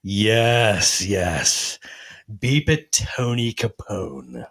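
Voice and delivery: low, drawn-out relish; gleeful anticipation. Retold or imitated. Watching the driver beep at Tony Capone, gleeful anticipation. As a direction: savour each word slowly. Savouring the driver's coming mistake.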